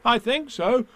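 put-on high boyish reply; young boy's voice. A narrator's reading. Boy answers Pooh about the umbrella, high child voice.

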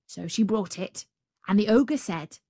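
usual speaking voice

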